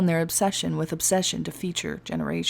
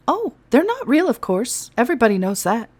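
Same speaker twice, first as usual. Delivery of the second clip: breezy; 5 semitones higher, 5 dB louder than usual. Acted. Elf breezy dismissal.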